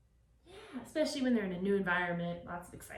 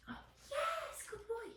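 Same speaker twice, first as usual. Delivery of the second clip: squeaky bright puppy praise, much higher; 9 dB quieter than usual. Real, not acted. Trainer praising puppy for eye contact, high excited praise. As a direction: lift way up to reward the pup.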